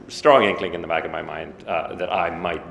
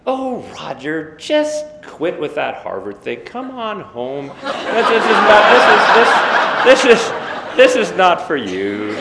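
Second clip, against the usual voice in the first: cheeriest mom voice; 9 semitones higher, 9 dB louder than usual. Retold or imitated. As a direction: chirp it like a delighted mom telling him to quit. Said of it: "Mom says in the cheeriest possible voice".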